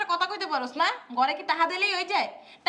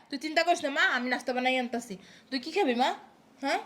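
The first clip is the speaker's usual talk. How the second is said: tender motherly; 8 semitones lower, 4 dB quieter than usual. Acted. Mother comforts pregnant daughter.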